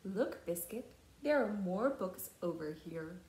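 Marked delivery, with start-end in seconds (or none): none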